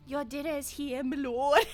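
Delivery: mock-British servant announcing dinner; hammed-up British accent, close to laughing; bad British accent. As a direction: serve it up in shoddy British. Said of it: "this really shitty British accent".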